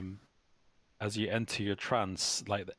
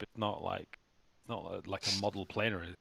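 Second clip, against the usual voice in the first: ordinary talk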